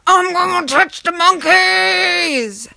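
put-on high, slow drawn-out child yell; hammed-up high kid voice; wild little son. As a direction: stretch it out like a gleeful kid. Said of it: "he's like".

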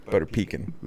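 pecan said as "PEE-kun", softly; mispronounced pecan. Shown as a demonstration. Guest sums up his quirky mistakes, pecan as "PEE-kun". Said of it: "the quirky dude saying".

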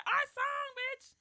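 very high-pitched mock squeal to a friend; excited squeal. Acting out calls between friends.

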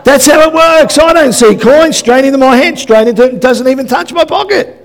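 preacher to congregation on his vanishing money, loud high-pitched rant; comic ranting. Acted. Preacher joking about money straight into another's pocket, comic outburst.

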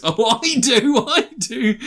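high, drawn-out, laughing excitement; hammed-up slow, high glee; mock doggy eagerness. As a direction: play an eager pet begging for food. Stray man eager for kibble.

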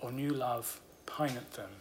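slow, hushed reading of a Romantic ode; poem recitation. Acted. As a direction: let each line sink, fading to a whisper at the end. Reads Keats ode aloud.

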